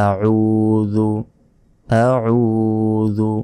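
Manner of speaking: আউযু drawn out very slowly, ain from the throat; آعوذو with a long throat ain; correct Arabic pronunciation. Shown as a demonstration. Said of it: "বলতে হবে আউযু".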